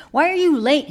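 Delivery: sharp, loud, high demand; an irritated hermit demanding answers; stern demand. Acted. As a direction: scold her for being late. Monk scolds the late nun.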